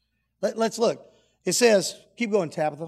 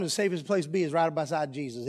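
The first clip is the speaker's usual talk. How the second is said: ordinary talk, nothing marked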